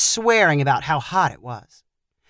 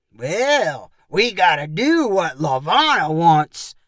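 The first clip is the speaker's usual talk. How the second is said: slow, sing-song mockery; mocking; slower than usual. A narrator's reading. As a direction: drag it out to mock him. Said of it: "said in a mocking voice".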